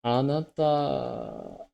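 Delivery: slow, drawn-out anime-style Anata; weeb voice. Pretend. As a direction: draw out the word like an anime fan. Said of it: "We need to hear it in the full weeb voice".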